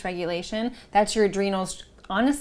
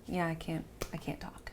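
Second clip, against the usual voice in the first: flat, much softer, fading to a near whisper; drained deadpan; 3 semitones lower, 13 dB quieter than usual. Retold or imitated. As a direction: sound like the life has been sucked out of you.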